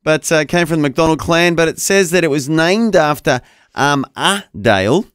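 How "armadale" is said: ordinary talk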